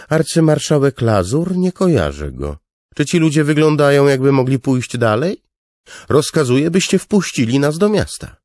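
loud, high, imperious demand; a king who expects to be obeyed; indignant king. Acted. King to the gate guard, demanding entry, loud and high.

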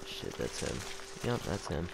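hushed, tense whisper. Real, not acted. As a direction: whisper so he won't hear. Enemy spotted while hiding.

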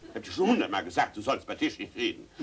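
a hint of a stern mother at the table; lightly voiced mother telling off her son; scolding mother.

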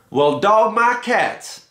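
put-on high, slow, drawn-out old guru voice; the hermit guru answers Roy, amused and slow; Baba Fats. A narrator's reading. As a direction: stretch it out like a wry old hermit. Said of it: "said Baba Fats".

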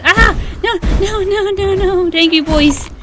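high, mock-alarmed string of no's; playful panic. Player fleeing hostile slimes, playful panic. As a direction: yelp and protest as if being chased.

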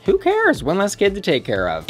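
high, sassy flip of a line; sassy drag queen. Pretend. Mock callous joke about kids.

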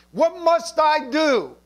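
high, forceful stress on "must I do"; emphatic quoted question. Retold or imitated. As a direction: drive "must I do" home, drawn out. Preacher re-voices the works-minded question.

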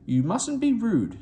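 high, slow fussy scolding; two prim coaches tutting; high prim slow reproach. A narrator's reading. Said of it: "they kept telling Thomas".

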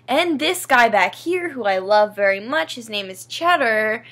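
ordinary talk

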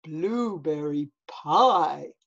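low, slow, savouring bear voice; deep, drawn-out greedy bear; storybook bear. Acted. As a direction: drawl it deep like a contented bear. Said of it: "said the bear".

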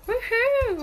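high, drawn-out woohoo; excited cheer. Real, not acted. Vlogger cheering her bathroom tour, high excited whoop.

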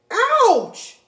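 high, loud squawk of pain; hen's cry of pain. Acted. Henny Penny hit on the head, a high yelping ouch. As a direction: yelp as the acorn hits. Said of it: "cried Henny Penny".

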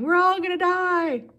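very high, loud wail of doom; panicked yell. A narrator's reading. Passengers run in circles after the crash, yelling in panic. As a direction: shout it in a panic.

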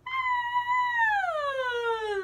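very high, loud roller-coaster squeal; a quick squeal like at the top of the ride; high playful scream. Shown as a demonstration. Teacher showing kids a roller-coaster scream, high squeal. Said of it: "you might scream really high".

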